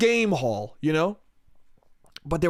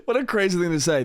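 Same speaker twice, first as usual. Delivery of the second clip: ordinary delivery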